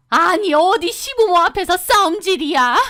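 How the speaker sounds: jab a finger and rail at her; scolding mother-in-law. Acted. (삿대질하며 높이 호통치는 시어머니)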